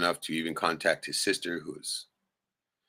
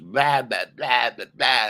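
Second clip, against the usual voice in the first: loud, higher-pitched mock blabbering; mocking chatter; 6 semitones higher, 7 dB louder, much slower than usual. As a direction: imitate someone ranting nonstop. Mimics sister talking over him.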